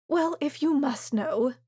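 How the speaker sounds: a hint of a mother savouring her secret; coy mother. A narrator's reading. Mother coy about rabbi.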